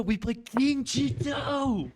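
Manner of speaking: high, slow, savoring each word; drawn-out excited reveal; thrilled. Real, not acted. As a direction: savor the move with glee. Explaining the winning line.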